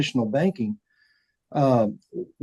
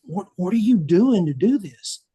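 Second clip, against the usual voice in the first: higher, lightly acted amazed question; a touch of disbelief, pitched up; retold astonishment; 7 semitones higher than usual. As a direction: replay the shock of seeing his numbers. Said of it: "I looked at him and I said".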